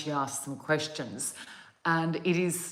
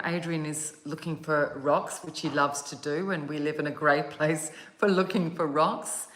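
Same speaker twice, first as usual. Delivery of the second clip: ordinary talk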